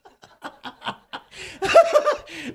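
high, quivering small-dog noise; comic trembly dog impression; imitated trembling little dog. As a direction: shiver out loud like a nervous little dog. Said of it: "littler one's like this".

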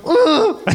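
high, loud comic groan; mock groan. [name] groans when Steph asks where they're going, high loud mock groan. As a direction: groan like a cornered guy.

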